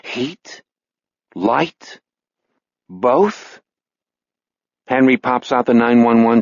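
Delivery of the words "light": usual speaking voice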